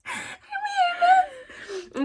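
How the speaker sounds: high, tiny, whimpery schoolgirl voice; timid little pupil. Retold or imitated. Brown pupil answering a sub teacher's roll call, tiny and meek. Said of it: "and I'm like".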